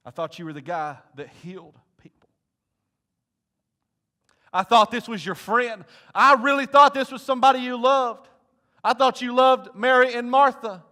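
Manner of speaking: rising into a loud, wounded accusation; accusing, building. Acted. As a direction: start hurt, end nearly shouting. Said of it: "I'll tell you what I think Jesus heard".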